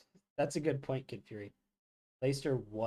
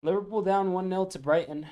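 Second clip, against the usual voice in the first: higher, louder commentary call; play-by-play voice; mock commentator; 9 semitones higher, 6 dB louder than usual. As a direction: announce it like a broadcaster. Commentating the match.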